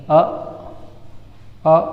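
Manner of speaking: अ as a short, relaxed 'uh'; neutral vowel demo. Shown as a demonstration. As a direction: say अ briefly and on its own. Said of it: "जैसे normal आ होता है". (छोटा सामान्य अ बोलें)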